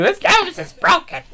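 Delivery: high, slow, strained mock yell; mock scream. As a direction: scream it like a hurt wrestler. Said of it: "he screams it too".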